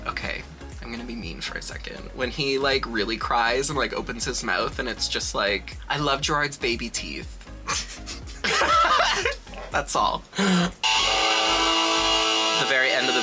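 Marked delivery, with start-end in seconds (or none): 0.1-2.0 whispering. Real, not acted. Conspiratorial aside before teasing. 7.4-9.7 high giggle. Real, not acted. Laughs at Gerard's teeth.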